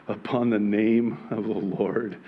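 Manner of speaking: ordinary talk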